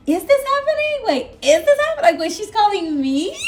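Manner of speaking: high, loud reenacted astonishment; excited disbelief. Reenacting stunned disbelief at the call.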